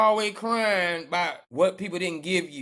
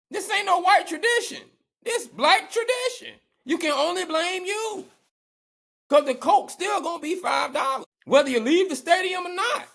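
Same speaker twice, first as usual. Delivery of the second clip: shouting; 9 semitones higher, 3 dB louder than usual. Real, not acted. Heated rant, blaming the audience.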